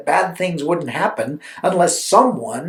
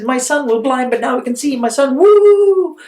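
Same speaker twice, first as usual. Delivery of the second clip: high, cheering parent rising to a whoop; excited parents; 6 semitones higher, 5 dB louder than usual. Acted. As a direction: celebrate like a thrilled parent. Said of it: "would be so excited".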